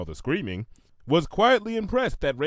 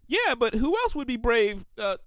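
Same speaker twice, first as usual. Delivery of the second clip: high admiring pony voice; 11 semitones higher than usual. Acted. Shimmer character voice.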